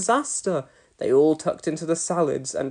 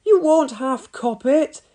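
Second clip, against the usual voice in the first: put-on cheeky boy; 8 semitones higher, slower than usual. A narrator's reading. Cheeky boy warns mum.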